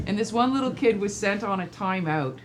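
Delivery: ordinary delivery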